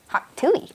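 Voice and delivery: "hot tooey" slow, with an extra -ey on "tooey"; "tooey" said slow and drawn out; Granny's way of saying it. Retold or imitated. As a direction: stretch "tooey" slowly like Granny. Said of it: "she doesn't even say hot too".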